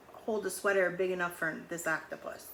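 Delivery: plain reading voice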